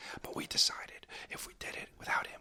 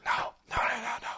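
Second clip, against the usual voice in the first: urgent no; 7 semitones higher, 5 dB louder than usual. Real, not acted. Hushing Brian urgently.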